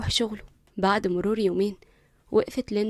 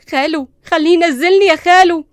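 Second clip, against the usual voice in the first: loud high call for help; pleading scream; 8 semitones higher, 11 dB louder than usual. Acted. Linda crying out to her uncle, loud and desperate. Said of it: "صرخت ليندا بصدمة".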